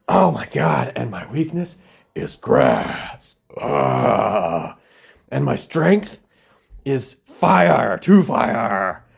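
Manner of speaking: hammed-up moaning with a mock sob; mock dramatic Charizard.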